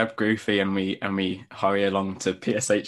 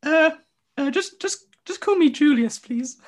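much higher squeaky nervous voice; timid mouse; 4 dB louder than usual. Acted. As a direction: squeak out your name nervously. Mouse character answers shyly.

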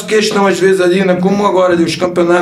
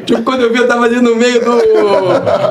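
high voice breaking into laughter; laughing as he tells; 6 semitones higher than usual. Real, not acted. Player recalling his wild celebration, laughing as he talks. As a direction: tell it through laughter, stretching the words.

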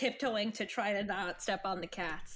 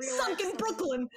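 Brooklyn accent; 8 semitones higher than usual. Shown as a demonstration. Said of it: "say Brooklyn".

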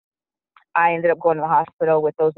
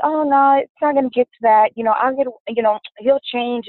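higher, breezy dismissive denial; naive brush-off; 6 semitones higher than usual. Retold or imitated. Retelling how she dismissed the officer's warning, higher and breezy. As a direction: wave it off like it's nothing. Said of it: "And I was like".

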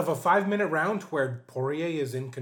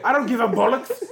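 mock Irish accent; 5 semitones higher, 6 dB louder than usual. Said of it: "That's a good impression".

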